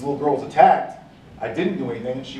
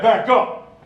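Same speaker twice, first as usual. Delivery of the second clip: higher, louder bark; angry command; 8 semitones higher, 5 dB louder than usual. Retold or imitated. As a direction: snap the order. Sergeant orders him back.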